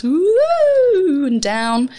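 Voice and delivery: high swooping whoo falling down; exaggerated cartoon intonation. Shown as a demonstration. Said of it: "you make your voice go up and down".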